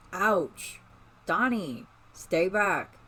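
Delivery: high loud pained yell. A narrator's reading. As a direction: shout to keep her away. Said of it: "he yelled".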